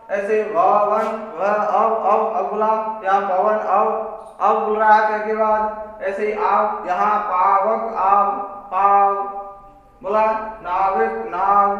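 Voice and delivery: slow, each sound split: अव, आव; slow sounding-out demo. Shown as a demonstration. Teacher drilling pupils on अव and आव, slowly. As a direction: drag अव and आव out in पवन, पावक. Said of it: "ये इनका उच्चारण होता है".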